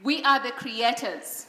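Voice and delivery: loud, firm declaration for the crowd to echo; with conviction. Shown as a demonstration. Speaker leading an audience in affirmations, loud and firm. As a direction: call out each affirmation so they repeat it. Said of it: "Please say this with conviction".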